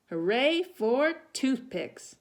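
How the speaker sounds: loud, slow proud cheer; celebrate, triumphant. Acted. Jessica cheers.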